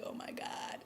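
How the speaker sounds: breathy whispered oh my God, voiceless; whispered aside. Retold or imitated. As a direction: whisper it to yourself as if you just guessed the twist. Reacting to the donation reveal.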